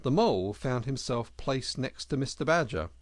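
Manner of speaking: ordinary delivery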